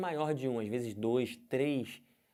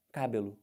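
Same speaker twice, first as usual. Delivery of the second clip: "cabelo" with the stress moved to CA, CÁ-belo; wrong first-syllable stress; 4 semitones lower than usual. Shown as a demonstration. Teacher tests an invented accent, stressing the first syllable. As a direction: hit "ca" in "cabelo", let "belo" fall away. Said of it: "Do jeito que tá escrito com acento".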